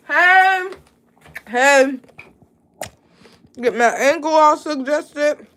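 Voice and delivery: calling out. Real, not acted. Calling out to get attention.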